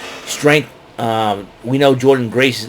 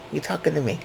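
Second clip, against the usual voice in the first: De Niro impression; 4 semitones higher, 10 dB quieter, faster than usual. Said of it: "I get a kick out of saying that".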